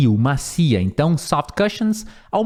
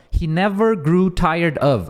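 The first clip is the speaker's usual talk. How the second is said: slow, careful read-aloud; much slower than usual. Shown as a demonstration. Teacher reading the key phrase slowly to the class. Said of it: "essa parte aqui".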